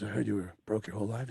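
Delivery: low, soft, quick murmur; confiding rock star. Retold or imitated. Eddie sharing what he heard with the comic, confiding. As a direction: sidle up and confide, quietly.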